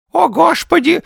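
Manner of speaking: put-on high, loud lisping old man; shrill toothless old man lamenting; mumbling old man. A narrator's reading. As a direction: wail it with a toothless lisp. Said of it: "зашамкал обитатель бревенчатого домика".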